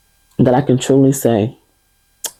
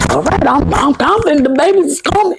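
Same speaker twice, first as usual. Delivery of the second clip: high, breezy pretend certainty; sing-song fake certainty pitched way up; mock confidence; 13 semitones higher than usual. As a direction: chirp it up high like you're sure. Mock confidence she didn't have.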